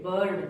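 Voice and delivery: बर्ड said with a short schwa, "burd"; बर्ड with the neutral uh vowel, not ee; correct vowel. Shown as a demonstration. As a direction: clear teacher model of बर्ड. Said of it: "इसको बिर्ड नहीं पढ़ेंगे".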